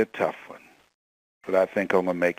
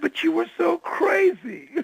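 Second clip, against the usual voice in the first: put-on high, raised voice of the old mentor; teasing mentor. Old mentor teasing him on the phone, high voice. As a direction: tease him about his wild youth.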